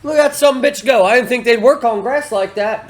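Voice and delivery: high, loud mock amazement; astonished onlooker. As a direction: imitate a cop hollering in disbelief. Acted cops watching skater flee.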